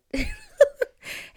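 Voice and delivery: high squealing laugh; delighted cackle. Real, not acted. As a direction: burst into a shrill happy laugh.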